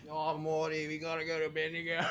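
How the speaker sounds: Australian Rick impression, pitched a bit up; Aussie Rick and Morty. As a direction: do an Aussie cartoon parody voice. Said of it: "the Aussie Rick and Morty thing".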